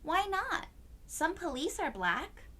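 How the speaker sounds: put-on a puzzled child objecting. A narrator's reading.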